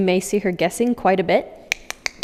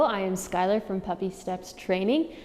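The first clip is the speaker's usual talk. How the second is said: normal talking voice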